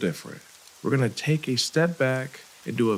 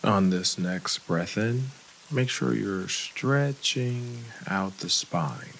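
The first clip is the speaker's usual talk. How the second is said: guided meditation. Real, not acted. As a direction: lead the breathing unhurriedly, with long gaps.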